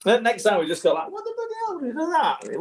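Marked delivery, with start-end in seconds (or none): 1.1-2.6 very high, breathy mock disbelief; shocked exclamation. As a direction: squeal it in exaggerated shock. Mock shocked owner reaction.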